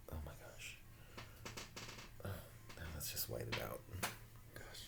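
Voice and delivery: breathy whispered oh my gosh; barely voiced, under the breath; quiet whispered aside. Real, not acted. Whispered reaction.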